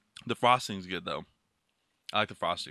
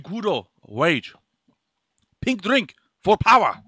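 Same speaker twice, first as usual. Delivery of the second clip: hammed-up Japanese accent, like an advert; mock Japanese accent; 3 dB louder than usual. Man sells pink drink in mock Japanese accent. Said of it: "So racist".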